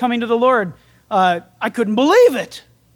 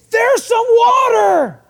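much higher and louder, drawn out slowly, shouted with joy; pumped exclamation; 10 semitones higher, 5 dB louder, much slower than usual. Retold or imitated. Preacher voicing the eunuch spotting water, excited shout. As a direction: cry it out like he just spotted the answer. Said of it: "it's with an exclamation point".

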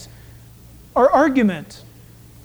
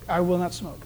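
softer mumbled echo; 6 semitones lower, 9 dB quieter than usual. Shown as a demonstration. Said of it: "Initial that".

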